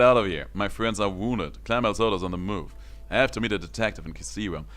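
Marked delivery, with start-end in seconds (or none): none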